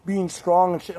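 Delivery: high, put-on patronizing voice; condescending. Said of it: "all condescending".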